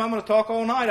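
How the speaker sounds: normal talking voice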